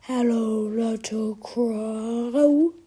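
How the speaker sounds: very slow, drawn-out monster bellow; big slow monster call. A narrator's reading. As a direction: draw each word out like a looming monster. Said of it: "bellows the monster crow".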